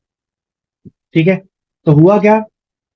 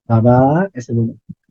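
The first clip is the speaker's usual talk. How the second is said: gentle; 6 semitones lower, slower than usual. Shown as a demonstration. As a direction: coax the baba sweetly. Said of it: "प्यार से बात करो". (प्यार से धीमे बोलना)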